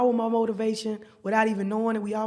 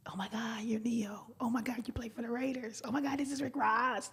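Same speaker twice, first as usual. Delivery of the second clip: breathless starstruck whisper; hushed mock fan awe; awed whisper; 2 semitones higher, 8 dB quieter than usual. Fans starstruck, retold.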